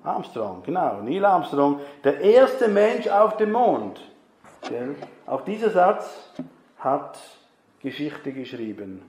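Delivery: usual speaking voice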